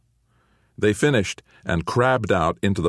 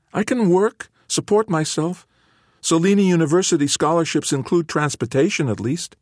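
put-on much higher, urgent teen voice; eager teen; 11 semitones higher than usual. A narrator's reading. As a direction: argue his case fast and eager. Teen son argues eagerly.

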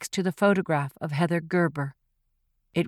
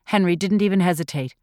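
ordinary delivery, nothing marked

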